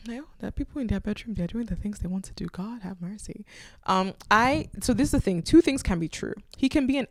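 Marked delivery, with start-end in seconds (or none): 2.5-3.5 muttered plea. Real, not acted. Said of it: "exasperated aside".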